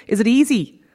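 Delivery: ordinary talk, nothing marked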